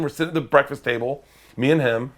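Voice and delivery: ordinary talk, nothing marked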